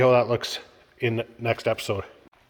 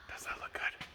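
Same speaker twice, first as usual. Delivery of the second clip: whisper; 20 dB quieter than usual. Real, not acted. Showing the wrap.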